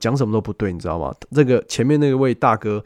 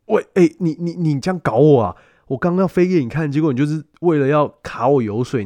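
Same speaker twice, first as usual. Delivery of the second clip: indignant outburst; 6 semitones higher, 4 dB louder than usual. Retold or imitated. Outburst at betraying vendor.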